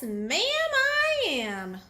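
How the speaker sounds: high, slow and proud; bright child bee voice; proud little bee. Acted. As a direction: draw out "ma'am, I am" high and proudly. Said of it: "I'll say, 'Yes".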